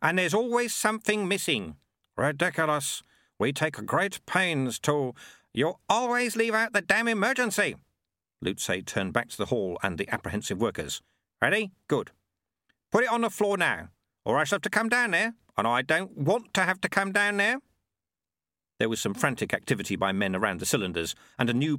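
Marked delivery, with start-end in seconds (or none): 0.0-7.8 raised, animated character voice; voiced character dialogue. Reader voicing an argument about the emergency, animated. 11.4-17.6 raised, firm order from the balcony; stern command. Acted. Lutze warning the workers from above, stern and loud.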